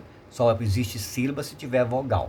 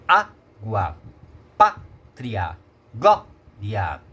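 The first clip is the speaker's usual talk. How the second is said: "água, pátria, glória" each broken after a punched first syllable; stressed tonic syllable; 2 semitones lower, 5 dB louder, much slower than usual. Shown as a demonstration. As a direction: hit Á, PÁ and GLÓ in "água, pátria, glória", pause, then finish each word. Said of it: "Apesar da sílaba tônica".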